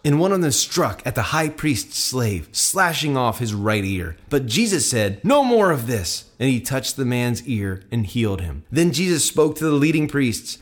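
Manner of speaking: clear, projected scripture reading; Bible story narration. A narrator's reading. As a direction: read the gospel aloud with measured drama. Reads Bible passage aloud.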